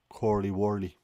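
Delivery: Curly Wurly in mock Dublin, as "Corly Worly"; Dublin accent. Shown as a demonstration. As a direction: imitate a Dublin mate saying Curly Wurly. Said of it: "either a Glaswegian or a Dublin accent".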